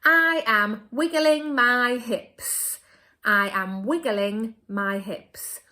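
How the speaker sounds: slow, emphatic model read loud; slow teacher demo sentence. Shown as a demonstration. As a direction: say it slowly for children to copy. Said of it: "I'm gonna go for".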